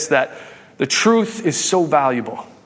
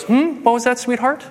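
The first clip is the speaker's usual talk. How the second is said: high-pitched, innocent mom voice; sweet mom; 7 semitones higher than usual. Retold or imitated. Mom answers her confronting child, sweetly oblivious. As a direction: play a mother pretending she didn't hear.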